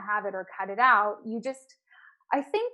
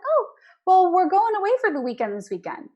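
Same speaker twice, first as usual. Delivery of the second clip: a light imitation of a breezy client; client's reply; 9 semitones higher, 7 dB louder than usual. Client answering her coach offhandedly, lightly imitated. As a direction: toss it off casually, lightly voiced.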